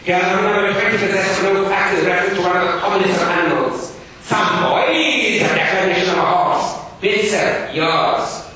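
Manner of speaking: loud, high, fast booming schoolmaster. Acted. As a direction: proclaim it to the whole class. Gradgrind proclaims loudly.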